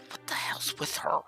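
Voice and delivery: whispering. Acted. Muttered aside about hostess.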